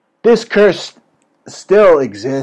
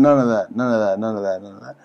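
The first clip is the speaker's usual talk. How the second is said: fading scold; 7 semitones lower, 8 dB quieter, faster than usual. Acted. Scolding co-host, fading away.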